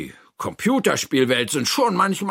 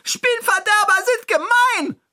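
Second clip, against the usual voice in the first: very high character yell; high-pitched roar; 4 dB louder than usual. Acted. Toni lets out anger at spoilsports, high-pitched roar. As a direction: shriek out the complaint. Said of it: "brüllt Toni".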